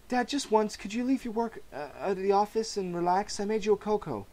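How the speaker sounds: coaxing daughter. Acted. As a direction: gently nag your dad to relax. Barbara coaxing her father.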